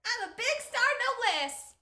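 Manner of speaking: high Southern whoop from far back; loud off-mic yell. Acted. Reporter whooping over a murder scoop, high distant holler. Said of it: "Hope that was loud enough back there".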